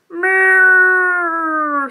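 high, loud, long moo; cow moo. Acted. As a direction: imitate a cow with a held call. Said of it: "says the cow".

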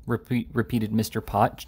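ordinary delivery, nothing marked

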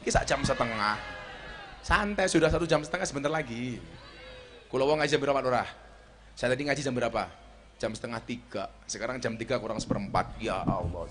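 ordinary delivery

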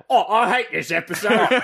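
light mock Cockney, laughing; Cockney accent. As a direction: play a laughing Cockney grump. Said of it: "hearing your voice".

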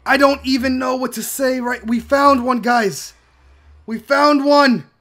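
amazed and overjoyed, drawing words out; overly excited. Real, not acted. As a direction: marvel at it in disbelief. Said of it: "Maybe I'm getting overly excited".

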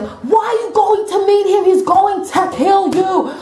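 high, stretched-out mock scream. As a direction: wail it at the movie screen without full volume. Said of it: "I wanted to start screaming at the screen".

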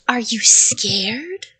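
slow, loud monster question; creature. A narrator's reading. Creature to the trapped child, slow monster voice. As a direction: draw out the menacing question. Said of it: "the creature asked".

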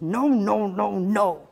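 stomping tantrum shout; angry refusal. A narrator's reading. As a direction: hammer out each word loudly. Troll stamps angrily, refusing.

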